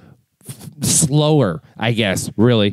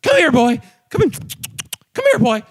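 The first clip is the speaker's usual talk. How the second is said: high, coaxing calls to a dog; higher, cooing come here boy; calling the dog; 8 semitones higher than usual. Retold or imitated. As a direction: sweet-talk the dog over. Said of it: "and you're like".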